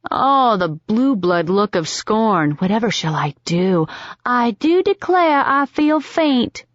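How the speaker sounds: sarcastic high drawling belle; mock southern belle. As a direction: ham up a fainting southern lady, teasing. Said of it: "Rose rolled her eyes".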